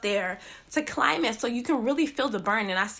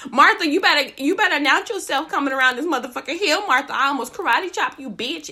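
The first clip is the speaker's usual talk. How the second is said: loud warning shout at a stranger; startled yell; 2 semitones higher, 5 dB louder than usual. Retold or imitated. Hiker yelling at a stranger who rounded the hill, loud and scolding. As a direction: holler at her to announce herself.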